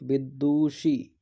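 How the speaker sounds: Vidushi drawn out slowly, bi-du-shi; teacher slowly sounding out Vidushi; slow spelling out. Shown as a demonstration. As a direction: stretch Vidushi syllable by syllable. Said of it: "विदुषी छोटी की मात्रा आएगी".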